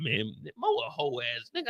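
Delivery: low dismissive heckler; deep mocking chat voice. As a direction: drop low like a sneering heckler. Voicing the chat insulting him.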